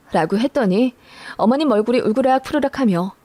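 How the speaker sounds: plain reading voice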